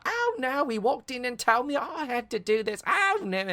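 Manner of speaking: high mock Cockney maid, fretting; imitating a flustered Cockney maid; squeaky put-on Cockney panic. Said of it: "another Cockney".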